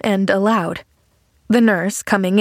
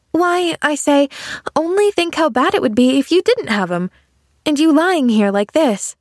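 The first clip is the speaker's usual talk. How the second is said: put-on higher, emphatic child's voice; insistent little girl Pollyanna; 8 semitones higher than usual. Girl urging injured man to be glad, insistent. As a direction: repeat it earnestly, like a determined child.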